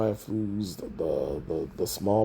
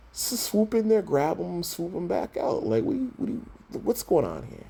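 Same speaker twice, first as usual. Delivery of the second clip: high-pitched, exasperated ranting; 8 semitones higher than usual. Real, not acted. Fan baffled Superman won't save his dad, exasperated. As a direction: voice jumps way up in disbelief.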